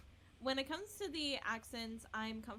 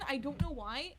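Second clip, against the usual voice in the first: usual speaking voice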